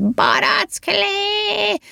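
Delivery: very high, long-stretched screech; throat-tearing yell. A narrator's reading. Elf's latest drink idea, a throat-tearing high yell. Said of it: "ilyesféle torokszakasztó ordítás formájában".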